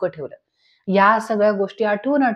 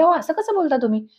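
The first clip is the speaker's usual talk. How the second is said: higher-pitched, mild wifely protest; mildly irritated; 6 semitones higher than usual. A narrator's reading. Wife objecting to her husband's suggestion, mildly irritated. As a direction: rise in pitch with a touch of annoyance. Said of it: "Mainavati जरा चिडतेच".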